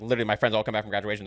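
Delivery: ordinary delivery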